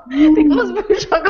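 parle en éclatant de rire; en riant. Real, not acted. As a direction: rire incontrôlable entre les mots. Laughing at the camera close-up. (phrases hachées par le fou rire)

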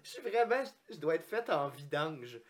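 high-pitched giggling through the words; can't keep a straight face; laughing. Real, not acted. Laughing at own fart.

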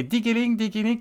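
little bell jingle, sung high; high tinkling bell imitation. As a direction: chime it like a tiny bell.

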